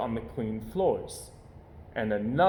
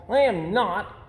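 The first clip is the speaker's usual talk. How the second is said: protesting; 8 semitones higher, 4 dB louder, slower than usual. Acted. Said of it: "protested Brother".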